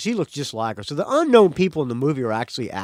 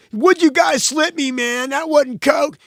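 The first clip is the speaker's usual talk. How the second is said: acted quote; 10 semitones higher, 5 dB louder than usual. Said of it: "of quote".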